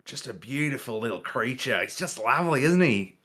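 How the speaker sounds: lilting mock Australian admiration; Steve Irwin. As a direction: coo like an Aussie wildlife host. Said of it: "like, Steve Irwin".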